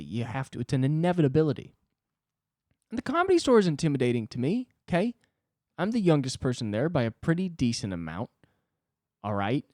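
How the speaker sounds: ordinary delivery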